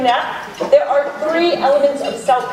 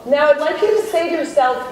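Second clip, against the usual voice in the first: guided meditation. Acted. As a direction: ease the room gently inward, unhurried. Leads a self-compassion meditation.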